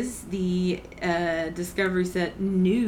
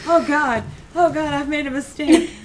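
mock panic; 8 semitones higher, 6 dB louder than usual. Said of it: "I'm like".

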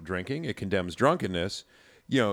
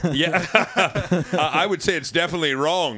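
laughing, higher and louder; 8 semitones higher, 5 dB louder than usual. Real, not acted. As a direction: laugh as you answer. Laughing at heresy question.

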